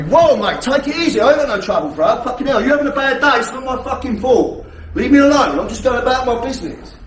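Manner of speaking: loud, high firm boundary-setting; firm and loud, backing off; assertive loud voice. Shown as a demonstration. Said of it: "I took my voice to seven or eight".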